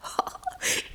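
silent airy snicker; breathy gleeful giggle. Acted. Ariel giggles to Prospero about the fooled drunkards. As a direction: giggle under the breath at the fools. Ariel gloating over trick.

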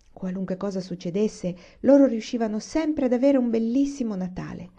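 ordinary delivery

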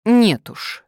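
резко и громко; отрежь твёрдо; firm cut-off. A narrator's reading. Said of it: "твёрдо отрезала Надежда". (твёрдо обрывает)

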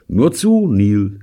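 high, loud teasing encouragement; encouraging. Acted. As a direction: egg him on mockingly. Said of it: "ermunterte ihn Robert". (spöttisch ermunternd)

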